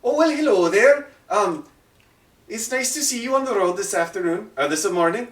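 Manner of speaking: a hulking brute putting on a sweet, high hello; big man's greeting. Acted. Game master voices the large man greeting travellers, high and fake-friendly. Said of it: "And he goes".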